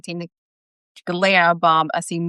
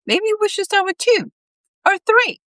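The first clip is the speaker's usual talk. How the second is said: high hopeful suggestion; Bob voice. A narrator's reading.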